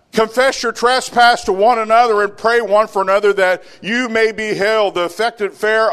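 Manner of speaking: loud, high preaching; sermon at full force; fiery preaching. Acted. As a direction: press the congregation hard. Preached scripture reading.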